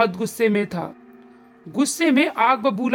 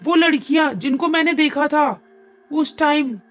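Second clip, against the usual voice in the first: high, loud panicked cry; terrified scream; 6 semitones higher, 4 dB louder than usual. A narrator's reading. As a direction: shriek in terror at the ghost girls, high and loud. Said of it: "जोर से चीखते हुए बोली". (डर से ऊँची, तेज़ आवाज़ में चीखना)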